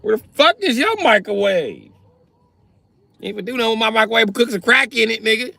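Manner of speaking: high, loud, fast indignant rant; heated comic rant; angry rant. Acted. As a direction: tell him off hard. Ranting back at the crackhead.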